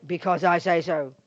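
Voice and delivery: P=A; authoritarian parent. Retold or imitated. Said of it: "He does not say".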